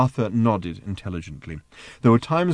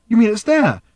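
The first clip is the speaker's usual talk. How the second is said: much higher and louder, thrilled; excited; 9 dB louder than usual. A narrator's reading. Arthur to Ford on finding Earth, excited. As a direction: burst out with delight. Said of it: "said Arthur excitedly".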